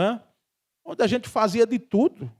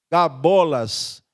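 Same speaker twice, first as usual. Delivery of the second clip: ordinary talk, nothing marked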